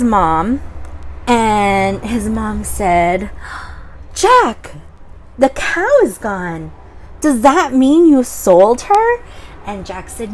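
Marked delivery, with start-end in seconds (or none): 4.1-9.2 higher, louder questioning mom; a hint of a worried mom; mother voice. Mother asking Jack about the missing cow, higher voice.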